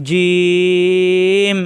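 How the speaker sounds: জিম with a long, loud, level ই; elongated vowel. Shown as a demonstration. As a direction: sustain the ই of জিম loudly before the m. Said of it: "লম্বা করা যাচ্ছে". (জোরে লম্বা টানে জিম)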